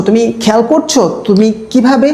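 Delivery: normal talking voice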